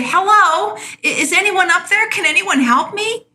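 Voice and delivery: high, strained call up the cliff; panicked man dangling from a cliff, calling up for anyone; upset cry for help. Retold or imitated. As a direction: plead upward like a man hanging from a branch. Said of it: "get very upset and call out and say".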